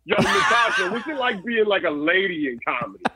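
high, casual dude voice; bro caller. Pretend. Imagined caller asking Natasha about women in comedy, mock. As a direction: toss it off like a clueless bro. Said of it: "What if the caller was like".

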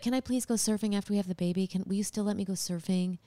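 quick soft begging voice; pleading husband. Retold or imitated. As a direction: beg quietly, rushing the words. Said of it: "he's like".

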